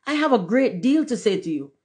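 high-pitched urgent call; screamed. A narrator's reading. Rocket to the duck swimming away, calling out. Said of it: "screamed the rocket".

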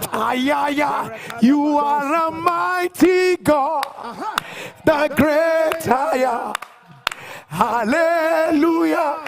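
slow, drawn-out shouted praise; fervent praise. Real, not acted. Preacher hailing the mighty God, in slow shouted praise. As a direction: cry out to God, stretching each word.

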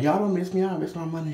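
sassy retort. Retold or imitated. Telling clerks off.

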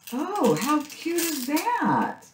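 slow, high delighted coo over a cute find; high gushing squeal. Real, not acted. Crafter gushing to viewers over a cute sticker, high delighted coo. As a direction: melt over it in a high drawn-out voice.

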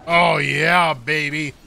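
loud, high gamer hype; excited cheering. Real, not acted. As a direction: cheer the clones on loudly. Fighting the boar boss.

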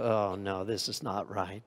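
low, soft disapproving tut; head-wagging disapproval. Shown as a demonstration. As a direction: shake your head and mutter your disbelief. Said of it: "where you just go".

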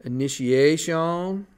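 "Initiation" stretched slowly, ending in a long "shawn"; sounded out. Shown as a demonstration. Teacher writing the first step, sounding out "Initiation" slowly. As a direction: draw out "Initiation" syllable by syllable, rising at the end. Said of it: "It's just like how it sounds".

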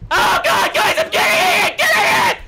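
very high, loud shrieked words; panicked screaming. Real, not acted. Player begging team to get the attacker, panicked shriek. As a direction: scream frantically to your team.

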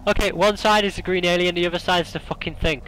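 ordinary talk, nothing marked

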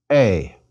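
"A" starting straight on the vowel, no H; smooth breathing. Shown as a demonstration. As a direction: say "A" like "ay", without any H. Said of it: "should be pronounced without an H".

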